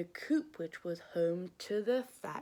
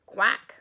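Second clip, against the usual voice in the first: a loud quacking duck; hammed-up farmyard quack; 12 dB louder than usual. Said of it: "said the duck".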